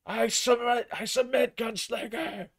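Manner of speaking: a beaten man's high pleading yield; instructor crying off. Acted. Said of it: "the instructor calls".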